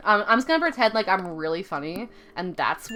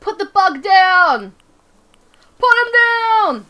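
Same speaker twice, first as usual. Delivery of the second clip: exasperated yelling; 7 semitones higher, 7 dB louder than usual. Real, not acted. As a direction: yell it in rising exasperation. Frustrated yelling at the game.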